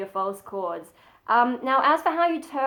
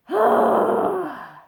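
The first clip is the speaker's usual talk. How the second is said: high, louder breathy sigh with more air; airy false chord sigh; 9 semitones higher, 5 dB louder than usual. Shown as a demonstration. Showing viewers the false chord version, airy sigh. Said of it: "So you're going from ... to".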